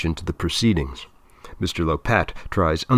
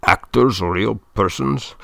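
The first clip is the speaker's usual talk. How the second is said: put-on director's German-accented incredulous question; 3 semitones higher, 3 dB louder than usual. A narrator's reading. Director scoffing at Mister Lopat's question, German accent. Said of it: "he says in his German accent".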